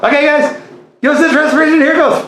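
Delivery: high, fast chipmunk chatter; sped-up squeaky cartoon voice. Real, not acted. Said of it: "we're talking like chipmunk".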